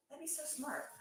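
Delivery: high-pitched approval at normal volume; voiced realisation. Real, not acted. Repeats the reaction aloud.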